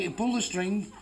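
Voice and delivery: usual speaking voice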